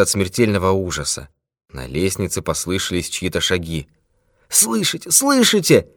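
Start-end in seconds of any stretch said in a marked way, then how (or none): 4.5-5.9 very high, frightened moan; moaning in fear. A narrator's reading. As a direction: moan it in dread. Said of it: "простонал он".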